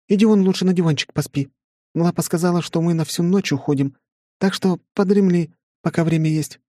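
put-on high, firm girlish refusal; bossy young woman voice. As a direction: shoo him off, high and firm. Flora refuses, sends him away.

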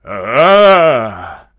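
loud gloating bark; a big peasant roars in triumph. Acted. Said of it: "рявкнул большой мужик".

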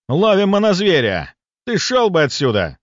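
loud, high, aggressive retort; menacing hunter. Acted. Hunter telling the intruder to clear off, threatening. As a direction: snap back to drive him off. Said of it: "Грозно взглянул на меня охотник".

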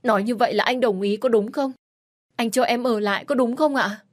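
high, loud eager questions; wife thrilled husband lets her stay; overjoyed. Acted. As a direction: burst out with hopeful joy. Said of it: "Tôi mừng quính".

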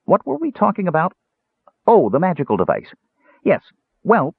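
high-pitched cheerful kender; chirpy, high character chatter; cheerful prattle. A narrator's reading. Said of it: "Tass continued prattling cheerfully".

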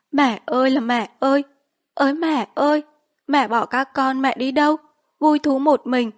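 high, loud keening funeral lament; wailing lament. A narrator's reading. Daughter-in-law wailing over her dead mother-in-law at the funeral, loud high keening. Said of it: "Nàng kêu thảm thiết như một con mèo gào đói".

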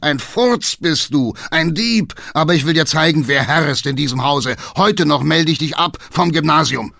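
loud, high, hammering tirade; furious bellowed threat; shouting in rage. A narrator's reading. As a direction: thunder at the son as master of the house. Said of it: "schrie der Alte".

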